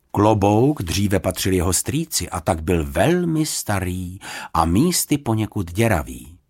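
plain reading voice

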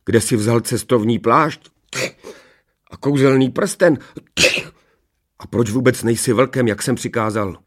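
indignant king taking someone to task; angry king. A narrator's reading. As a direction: demand answers, sharp rise in volume. King angrily questions the returning Rumburak.

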